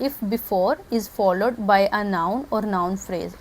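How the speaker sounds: each word spaced out so students can write it; slow dictation. Shown as a demonstration. Teacher dictates the grammar rule for students to note down, very slowly. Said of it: "लिख लें".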